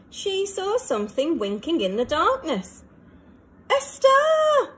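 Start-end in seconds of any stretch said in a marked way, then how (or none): 3.7-4.7 high, loud joyful shout; excited cry. A narrator's reading. Rocket spotting a star, shouts with delight. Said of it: "she cried".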